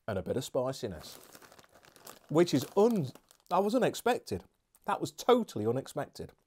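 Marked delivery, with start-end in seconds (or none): 2.3-6.3 genuine surprise. Real, not acted. Surprised by spice.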